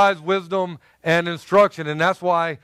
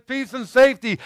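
raised, higher mocking call of peace; mock shout; 6 semitones higher than usual. Preacher mimicking people shouting peace. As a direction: call it out like a crowd chanting. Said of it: "they shout".

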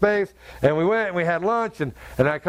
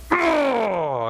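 choked cry; 4 semitones higher than usual. Real, not acted. Breaking down at God's words.

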